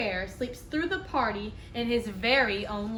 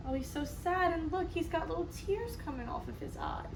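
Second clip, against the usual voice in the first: softer, sympathetic voice; tender aside; 4 semitones higher, 7 dB quieter than usual. Real, not acted. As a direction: say it gently, feeling for him.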